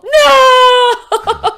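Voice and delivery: high, loud crying shout; emphatic no. Retold or imitated. Teen silently protests her mom's vow with a shout. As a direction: cry out in protest. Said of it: "thinking to myself".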